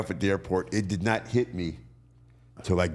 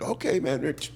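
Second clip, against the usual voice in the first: higher Canadian guard voice; guard waving a wrestler through, higher; 6 semitones higher than usual. Retold or imitated. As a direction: play the guard brushing it off, higher. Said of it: "he's like".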